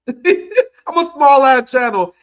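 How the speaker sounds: slow, laughing disbelief; laughing, a little teary; exasperated laugh. Real, not acted. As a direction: laugh at how absurd it is.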